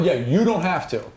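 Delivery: normal talking voice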